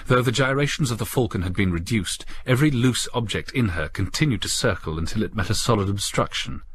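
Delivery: ordinary delivery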